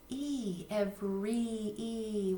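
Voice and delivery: slow syllable stretch; teacher stretching the word to show the ending. Shown as a demonstration. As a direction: draw out every to show the final E. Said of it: "stretching syllables to show sound".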